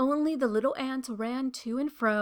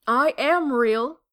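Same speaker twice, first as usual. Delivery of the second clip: slow, louder insistence; insisting; 7 dB louder, slower than usual. A narrator's reading. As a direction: plead your case, slow and louder. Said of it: "said the little rabbit".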